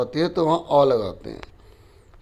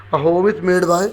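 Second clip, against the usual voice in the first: dictation read; 2 semitones higher, much slower than usual. Shown as a demonstration. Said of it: "teacher reads exercise sentence".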